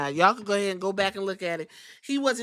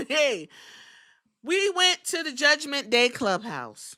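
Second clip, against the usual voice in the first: usual speaking voice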